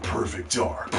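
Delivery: low, soft retold announcement; low announcement imitation. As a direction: say the title low and hushed. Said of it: "And then they said".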